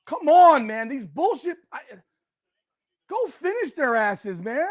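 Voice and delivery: high, exasperated protest at the team; upset pleading. Real, not acted. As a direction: urge them on in frustration. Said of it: "I'm sorry I'm getting upset here".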